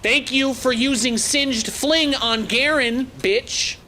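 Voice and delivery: high, slow, excited mock gratitude; excited sarcastic thanks. Real, not acted. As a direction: stretch it out, worked up and gloating. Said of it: "I'm getting excited".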